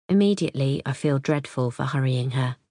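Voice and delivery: ordinary delivery, nothing marked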